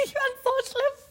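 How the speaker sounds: laughing high and slow through the words; embarrassed laugh. Real, not acted. Telling her friend how embarrassing it was, laughing. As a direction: squirm with giggles.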